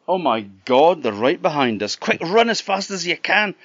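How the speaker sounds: sudden loud, high alarm. Acted. Jack spotting the pursuers, alarmed. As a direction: panic as they close in.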